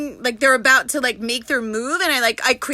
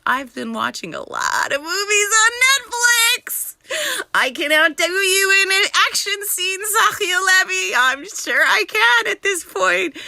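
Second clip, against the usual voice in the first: excited boasting; 8 semitones higher than usual. Real, not acted. Boasting of outdoing others in action scenes, excited. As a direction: crow it with theatrical gusto.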